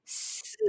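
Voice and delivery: す as a bare hissed English S; voiceless S with no vowel after it; S sound. Shown as a demonstration. Teacher isolating the first sound of seamless, unvoiced S. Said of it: "Sで始まる方の".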